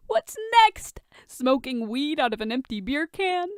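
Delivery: high, teasing mock question; mocking jab. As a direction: needle him with a gleeful jab. Tony mocking Bruce.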